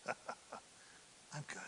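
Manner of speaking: tossed-off satisfied aside; smug whisper. Acted. David after sending Bathsheba away, smug whisper.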